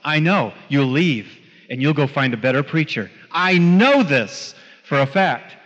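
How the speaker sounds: ordinary talk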